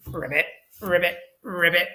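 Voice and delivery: light playful frog croak, quick; frog ribbit. Pretend. Teacher waving goodbye as Fred the frog, light playful ribbit. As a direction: chirp ribbit like a little frog. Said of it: "It goes ribbit".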